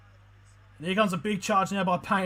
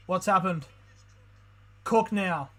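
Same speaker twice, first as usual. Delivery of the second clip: normal talking voice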